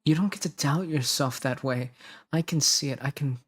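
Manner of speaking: earnest, slightly pleading reassurance; acted devoted partner, measured delivery. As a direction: plead gently with a doubting loved one. Retake of comforting lines.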